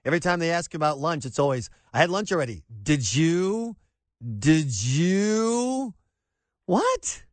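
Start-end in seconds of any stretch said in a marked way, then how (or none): 1.9-5.9 suspicious drawn-out did you; Woody Allen turning suspicious; Woody Allen impression. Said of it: "Woody Allen in the movie Manhattan". 6.7-7.2 much higher what; startled rising what; baffled. Real, not acted. Baffled outburst.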